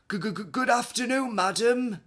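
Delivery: high, loud nervous stammer; stuttering. Acted. Startled councillor greeting Missus Rainbow, stammering nervously. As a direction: trip over the g's, startled. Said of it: "stutters a stout gentleman".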